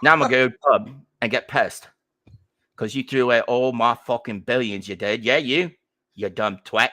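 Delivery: loud mock Cockney lad with a grudge; Cockney accent. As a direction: play a sneering Cockney son, loud. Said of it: "one of those Cockney fucks".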